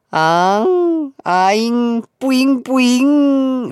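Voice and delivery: put-on high, babyish cutesy whine; 애교 부리는 목소리로; cutesy aegyo. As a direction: coo it like a sulky baby begging. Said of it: "애교의 제왕".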